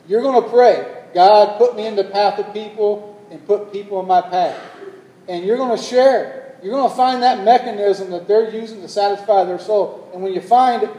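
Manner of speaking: loud, high preaching climax; a preacher driving home the point; fervent preaching. Real, not acted. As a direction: preach it with full force. Preaching at full force.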